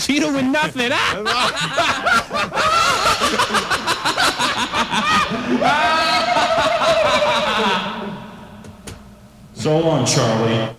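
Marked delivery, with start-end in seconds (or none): 0.1-0.9 higher, talking through real laughter; can barely get the words out for laughing. Real, not acted. Host laughs at caller's wrong answer. 1.0-8.4 long helpless high-pitched laugh; real laughter that keeps going. Real, not acted. Long laugh after wrong answer.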